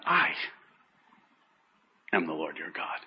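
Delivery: I set apart by a long pause, rest low and very soft; single out I, then sink into a hush; stress on I. Shown as a demonstration. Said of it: "I think the emphasis is on".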